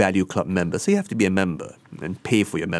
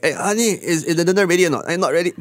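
impatient spouse; 10 semitones higher, much faster than usual. Retold or imitated. Acting spouse asking about dinner.

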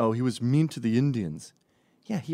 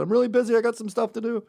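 much higher, louder hurried excuse; mock brush-off; 12 semitones higher, 5 dB louder, faster than usual. Kessler dodging the question on Skype, hurried excuse. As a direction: blurt an excuse and hang up.

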